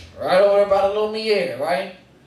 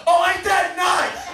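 mocking woke brother; 12 semitones higher, slower than usual.

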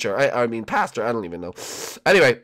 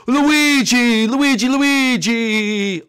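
high, loud, drawn-out calling; excited ghost friend; 11 semitones higher, 9 dB louder, much slower than usual. Acted. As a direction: wail the name in a high ghostly greeting. Ghost friend Blimpy greets Luigi.

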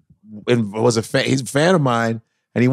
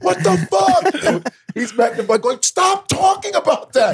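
high, slow yell, way up in pitch; exasperated yelling. Retold or imitated. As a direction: boss losing it at a crew gone off topic. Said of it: "And Jeff is going".

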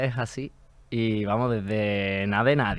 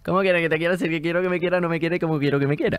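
fast tongue twister; 6 semitones higher, faster than usual. Shown as a demonstration. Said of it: "Repite tú el trabalenguas, a ver si te sale".

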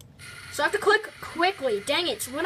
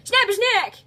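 loud, high shouted advice; urging on; 9 semitones higher, 8 dB louder than usual. Real, not acted. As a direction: egg the character on at full voice. Urging the game character on.

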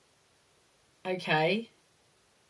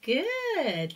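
ordinary talk

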